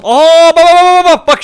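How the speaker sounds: high, loud excited calls; excited calling out. Real, not acted. Gamer spotting a bird, excited calling out. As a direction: point it out, thrilled.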